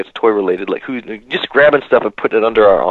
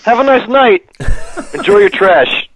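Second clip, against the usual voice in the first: high, breezy dismissive guard voice; easygoing guard; 10 semitones higher, slower than usual. Retold or imitated. Security guard waving off the trash-taking kids, breezily. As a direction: brush the kids off cheerfully, pitched up. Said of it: "he's like".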